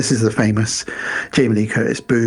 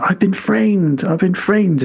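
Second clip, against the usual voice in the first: mock desperate plea; 7 semitones higher, slower than usual. Ruined man pleading to Ophelia.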